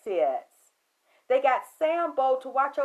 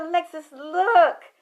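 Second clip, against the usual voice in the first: insistent shout; 5 semitones higher, 12 dB louder, much slower than usual. Retold or imitated. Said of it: "He said".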